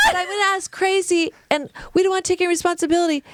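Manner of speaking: quick, high mock dub; weird dubbed voice. Mother dodging blame, mocked in a high, hurried dub voice. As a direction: rattle it off as the badly dubbed mother. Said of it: "weird ass, like, dubbed in voice".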